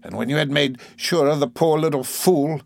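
accusing aunt. Acted.

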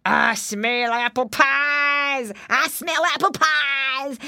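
slow drawn-out hungry wolf. A narrator's reading. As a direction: savor each word like a hungry wolf.

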